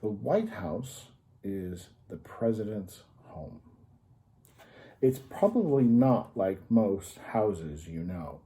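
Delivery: put-on bright, slow storybook read for kids; reading to children. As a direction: read it out like a picture book, savouring each item. Reads the children's book aloud.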